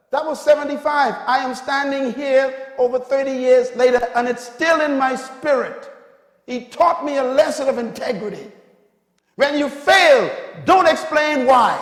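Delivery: loud, high, near-shouted sermon climax; full-force preaching. Real, not acted. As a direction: build to a shouted lesson for the congregation.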